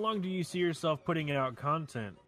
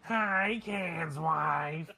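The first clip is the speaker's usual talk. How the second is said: sneak thief voice; 3 semitones higher, much slower than usual. Retold or imitated. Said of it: "like this".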